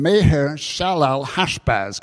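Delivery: Meher Shalal Hashbaz said slowly and carefully, syllable by syllable; drawn-out tongue twister. Shown as a demonstration. Preacher carefully sounds out the prophet's son's long name. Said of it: "kind of rolls off the tongue there".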